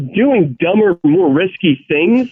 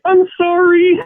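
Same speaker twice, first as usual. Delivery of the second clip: cringing sorry; 13 semitones higher, slower than usual. Retold or imitated. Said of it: "doing the cringe face at me, like".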